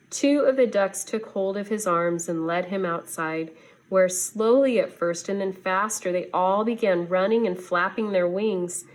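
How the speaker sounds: ordinary delivery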